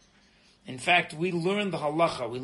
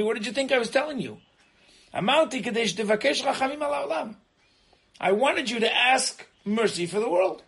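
higher, louder stern rebuke; God rebuking; 6 semitones higher, 6 dB louder than usual. Retold or imitated. God answers Noach, rebuking him loudly and sternly. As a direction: scold him as a foolish shepherd. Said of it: "And he says".

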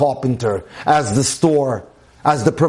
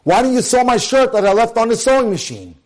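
higher-pitched mock-annoyed husband; exasperated, raised-pitch accusing question; angry demand; 6 semitones higher than usual. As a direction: act out an irritated husband making a complaint. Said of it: "begin their request for help in an angry fashion".

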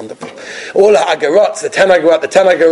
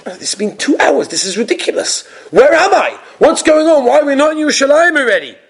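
a lost passenger working himself into a panic; bewildered traveler who suddenly realizes; panicked passenger; 9 semitones higher than usual. Retold or imitated.

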